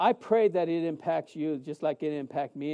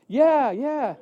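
faked recognition; 6 semitones higher, 5 dB louder than usual. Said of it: "which I couldn't remember".

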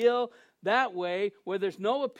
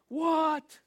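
high, louder mock-startled outburst; incredulous yelp, pitched way up; shocked disbelief; 8 semitones higher, 4 dB louder than usual. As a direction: blurt it out in retold shock. Retells shock at teaching assignment.

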